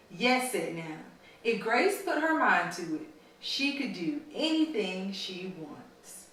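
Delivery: storybook read-aloud to a class; reading to children. A narrator's reading. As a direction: read it like a story for little kids. Teacher reads picture book aloud.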